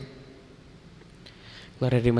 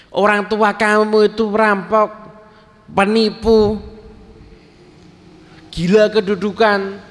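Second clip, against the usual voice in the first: raised jeering tirade; hammed-up abusive rant; 6 semitones higher, slower than usual. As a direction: spit the insults like a sneering accuser. Accuser heaps insults.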